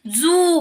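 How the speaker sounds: जू with a long, pressed ऊ; drawn-out oo sound. Shown as a demonstration. Teacher showing students the stressed oo sound. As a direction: lean on the ऊ in जू. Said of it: "जोर दिल्यासारखं होतं बघा".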